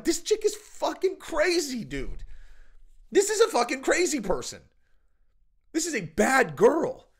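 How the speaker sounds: incredulous reaction. Acted. Reacting to these crazy stories, acted incredulity. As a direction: hammer it out in appalled amazement.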